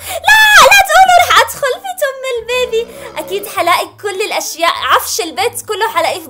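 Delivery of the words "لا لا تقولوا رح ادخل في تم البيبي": very high, loud panicked cry; horrified scream. Real, not acted. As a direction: shriek in disgust and fear. Horrified at entering baby's mouth.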